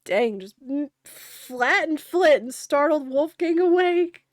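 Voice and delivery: loud, high astonished yell; shocked outburst. Real, not acted. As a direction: blurt it out stunned. Player yells in shock.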